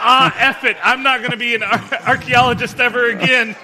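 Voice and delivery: high, loud, laughing rant; mock exasperation. Comedian voicing someone quitting archaeology, mock exasperated. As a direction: throw up a loud comic protest.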